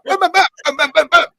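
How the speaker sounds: high, loud nonsense babbling like a tipsy rambler; mock drunken babble. Mocking a co-host who rambles after wines, high babble. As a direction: gabble away like someone a few wines in. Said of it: "you're just like that".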